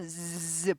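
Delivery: 즈 as a buzzing z like a bee; 즈 held as a bee buzz. Shown as a demonstration. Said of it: "벌들이 붕붕거리는 소리".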